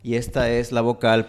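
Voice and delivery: usual speaking voice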